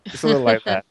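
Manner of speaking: normal talking voice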